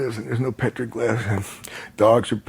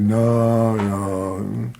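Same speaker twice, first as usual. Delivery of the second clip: mock sulky refusal. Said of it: "I'm just pouting".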